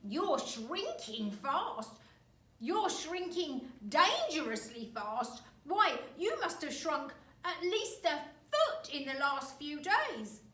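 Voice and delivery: put-on slow, loud, gloating old man; horrible grin. Husband taunts wife.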